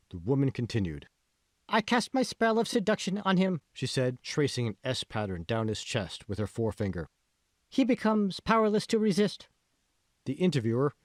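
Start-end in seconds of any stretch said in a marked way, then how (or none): 1.7-3.6 put-on very high, bright-eyed Japanese accent as a cosplay princess; strong Japanese accent. A narrator's reading. Cosplayer answers the reporter, in a high strong Japanese accent. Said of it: "Speaking with a strong Japanese accent". 7.7-9.5 put-on very high, bright-eyed Japanese accent as a cosplay princess; strong Japanese accent. A narrator's reading. Cosplayer answers the reporter, in a high strong Japanese accent. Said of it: "Speaking with a strong Japanese accent".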